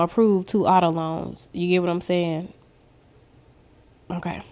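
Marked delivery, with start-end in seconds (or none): 4.1-4.4 a quick whispered okay; whispered aside. Real, not acted. Talking to viewers, a whispered okay.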